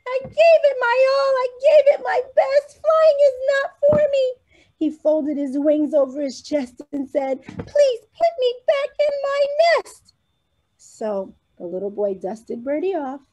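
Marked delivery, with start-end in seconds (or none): 0.1-4.3 very high, fast, louder weepy bird voice; a little bird giving up in frustrated tears; high, crying, upset little bird; sad and mad bird. Acted. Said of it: "Birdie was sad and mad all at once". 7.4-10.1 very high, fast, louder weepy bird voice; a little bird giving up in frustrated tears; high, crying, upset little bird; sad and mad bird. Acted. Birdie begs boy.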